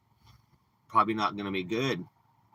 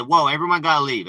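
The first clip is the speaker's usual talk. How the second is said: louder, higher call to clear out; retold yell; 6 semitones higher, 9 dB louder than usual. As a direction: shout everyone out of the RV. Breaking up a fight.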